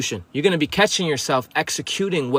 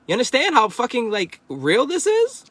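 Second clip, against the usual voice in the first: incredulous; 8 semitones higher than usual. Real, not acted. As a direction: stretch it out in disbelief. Incredulous high slow.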